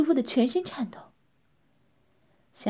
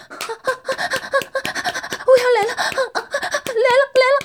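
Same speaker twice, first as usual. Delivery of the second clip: much higher, louder cries of climax; crying out; 8 dB louder, much faster than usual. Acted. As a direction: cry it out at full pitch. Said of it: "女人大叫道". (高声大叫)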